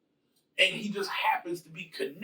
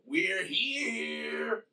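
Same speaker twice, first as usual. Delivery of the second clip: higher mock movie-hero entrance line; mock action hero; 7 semitones higher, 3 dB quieter than usual. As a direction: ham up a corny kick-the-door-in entrance. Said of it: "No, we, we're not gonna do that".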